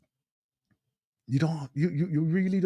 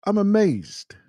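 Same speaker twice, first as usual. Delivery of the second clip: ordinary talk, nothing marked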